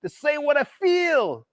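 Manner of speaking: put-on New York accent tried on after a long talk with Gerald; bad New York accent. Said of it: "That was probably a really bad impression".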